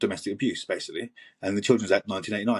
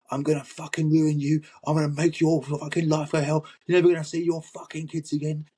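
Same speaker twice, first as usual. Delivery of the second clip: higher, rising furious rant, imitated; mock screaming voicemail; 6 semitones higher than usual. Ex-partner ranting on a voicemail, imitated furious yell. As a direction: act out an ex's threatening voicemail, pitch climbing. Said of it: "send fifteen voicemails screaming".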